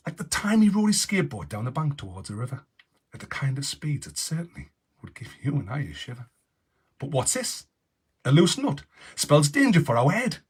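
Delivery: lively, bright storybook read-aloud; animated storytime voice; story narration. Acted. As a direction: read it like a children's story host. Reads his story aloud, announcer style.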